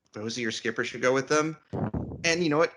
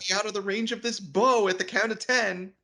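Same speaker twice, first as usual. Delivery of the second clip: high, fast warning; mock archer threat; 7 semitones higher, faster than usual. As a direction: bark the countdown threat.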